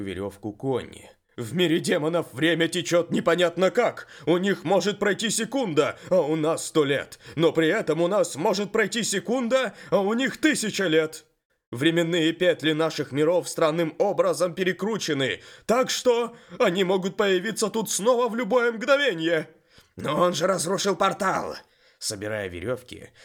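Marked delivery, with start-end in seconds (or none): 1.4-19.5 loud, high, urgent explanation; the horse lectures with alarm; talking horse. Acted. The horse explains demon worlds. 20.0-21.6 protesting lizard. Acted. Main objects to the horse.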